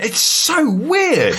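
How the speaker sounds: put-on high, slow, awed child's voice; drawn-out kid's wonder; amazed child. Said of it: "And he went".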